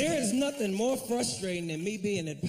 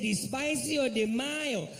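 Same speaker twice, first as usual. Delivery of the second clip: put-on higher, slow Spanish-accented cashier; mock Spanish accent; 6 semitones higher, slower than usual. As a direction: repeat it slowly to the confused customer. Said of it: "He said".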